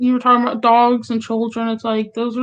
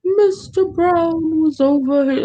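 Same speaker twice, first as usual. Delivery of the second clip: slow, higher-pitched British detective-show voice; mock British accent; 5 semitones higher, slower than usual. Daughter mimicking mom's slow British detective shows, mock British accent. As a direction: draw it out in a put-on British accent. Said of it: "like, British, like, detective shows".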